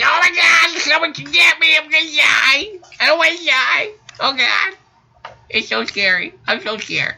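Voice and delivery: high, panicked mock scream; mock screaming coward. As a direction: squeal like a terrified kid running away. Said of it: "screaming like a little bitch".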